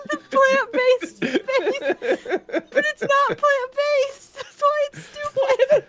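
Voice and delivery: laughing through the words, slowly; giggling, high and slow. Real, not acted. Laughing at own slip.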